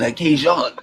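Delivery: Cajun with a French "zhon" ending; French accent. Shown as a demonstration. Showing the proper way to say Cajun, French accent. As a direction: give Cajun a French-style open last vowel. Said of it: "say it in your French accent".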